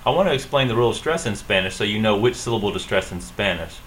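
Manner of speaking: normal talking voice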